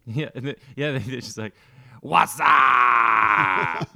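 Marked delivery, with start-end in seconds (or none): 2.0-3.9 long drawn-out shout; yelled catchphrase. Acted. Foreigner yelling movie phrases at people, drawn-out shout. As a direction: holler it like a movie quote, stretched out in mock accent. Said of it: "just yelling out movie phrases at people".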